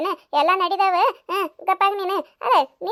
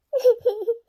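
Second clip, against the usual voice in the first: a short choked sob, pitched up; 3 semitones higher than usual. Acted. Lakshmi breaking down over her sister-in-law, choked sob. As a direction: break into one sudden sob.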